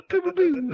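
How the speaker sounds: high, loud pew-pew sound effect; imitated laser noises. Comic capping a ninja joke, high mock blaster sounds.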